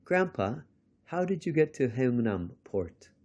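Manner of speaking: put-on high-pitched child's question, easing downward; curious grandchild. A narrator's reading. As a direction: call to Grandpa high, then ask. Grandchild asks Grandpa in story.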